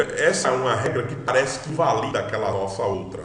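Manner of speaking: ordinary talk, nothing marked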